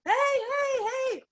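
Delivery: high, fast, excited bouncer calling for tequila; cheering bouncer. Retold or imitated. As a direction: hype her up to the bar. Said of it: "and he goes".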